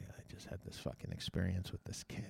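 very low, barely voiced aside; hushed confiding. Man leans over to Deb in line, hushed confiding. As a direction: confide it so nobody behind hears.

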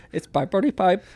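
badly faked Indian accent; Indian-sounding mock accent. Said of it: "Keep on going, Indian".

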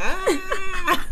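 high sudden shriek; startled shriek. Real, not acted. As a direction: yelp in surprise. Startled reaction to bite.

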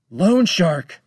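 high, loud alarmed shout. Acted. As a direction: yell the name in alarm. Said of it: "the captain shouted".

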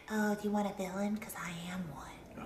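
breathy, sly villain whisper; whispered villain line. Retold or imitated. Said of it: "she says on the preview".

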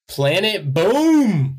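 slow, drawn-out, high hype; booming announcer voice. Pretend. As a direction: announce it like a monster truck rally. Announcing the next band.

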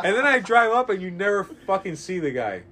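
ordinary talk, nothing marked

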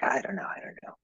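whispered imitation of unsure clients; hushed whispering "I don't know". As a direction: whisper it like someone lost for words. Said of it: "people are like".

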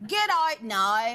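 slow attempt at a Belfast accent; copied Irish accent. Shown as a demonstration. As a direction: imitate the Irish accent, OUT as "ite". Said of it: "See if you can do this".

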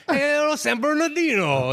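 high, slow comic greeting the crowd; hammed-up comedian character. His made-up comedian character greeting the crowd, hammed up. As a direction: belt out the hometown punchline. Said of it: "his outclaws would just be".

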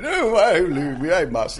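high, loud mock weeping; hammed-up tearful farewell. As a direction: overplay the goodbye again. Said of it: "And we're going".

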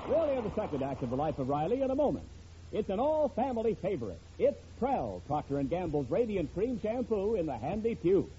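usual speaking voice

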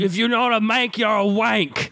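mock British accent. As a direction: chant it in fake British. Riffing in fake British accents.

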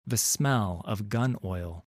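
plain reading voice

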